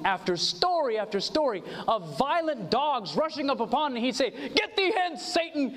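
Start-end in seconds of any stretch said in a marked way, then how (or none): 4.3-5.8 high-pitched, dramatized command to the devil; mock rebuke. Friend rebuking a violent dog rushing at him, mock commanding. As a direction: act out a friend banishing Satan.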